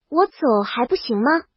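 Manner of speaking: ordinary delivery, nothing marked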